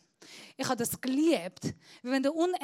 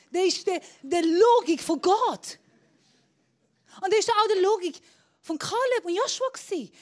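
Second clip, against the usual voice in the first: higher, louder fiery preaching; shouting preacher; 6 semitones higher, 4 dB louder than usual. Acted. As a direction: preach it at full force, no discussion. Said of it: "Ich tue viel schreien". (laut predigend)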